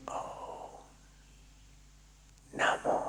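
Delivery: mantra barely breathed on the lips; lip whisper. Shown as a demonstration. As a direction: whisper the chant without making noise. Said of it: "अब होठों में बोलो, शोर मत करना". (होठों में फुसफुसाकर जाप)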